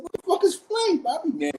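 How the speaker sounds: very high, baffled outburst; asking Bobby what flame is, shocked; incredulous question. Retold or imitated. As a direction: call out in disbelief, pitch way up. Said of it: "I said".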